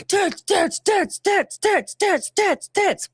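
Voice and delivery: high, punchy repeated chant; mock crowd yell. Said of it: "sound like multiple people are yelling it".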